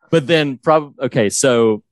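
ordinary talk, nothing marked

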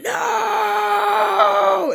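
long, high-pitched wailing cry; mock agonized yell. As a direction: imitate a drawn-out movie scream of despair. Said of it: "We hear him yelling".

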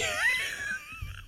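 high shrieking burst of laughter. Real, not acted. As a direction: let out a squealing cackle. Big laugh after teasing.

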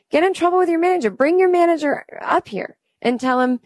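fast, high, incredulous rant; indignant outburst. Retold or imitated. Comedian scolding clerk over forced yogurt, high indignant rant. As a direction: fire it back at him in disbelief.

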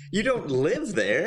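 mock scold. Mock scolding the old couple.

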